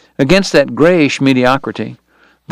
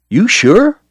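higher, doubtful voice; doubtful question; 9 semitones higher, 3 dB louder than usual. A narrator's reading. Driscoll kid doubting Doc can ride, doubtful. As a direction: question his nerve.

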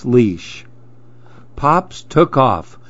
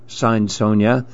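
ordinary delivery

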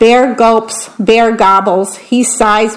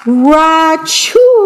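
sneeze; 6 semitones higher than usual. Acted. Bear sneezes in the story.

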